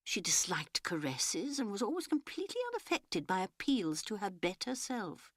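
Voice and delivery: usual speaking voice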